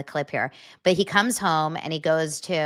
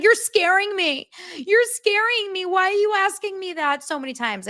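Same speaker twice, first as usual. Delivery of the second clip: shrill accusing bride. Retold or imitated. Bride accusing the drunk groom, high and shrill. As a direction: play her scared and accusing. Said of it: "she's like".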